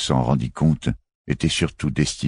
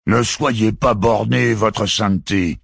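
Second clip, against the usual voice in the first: slow, higher, grumbled reproach to the pontiff; grumbling gardener; 8 semitones higher, slower than usual. A narrator's reading. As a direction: grumble it slowly, like an impatient old gardener. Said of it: "maugréa le jardinier". (jardinier qui maugrée, lent et plus aigu)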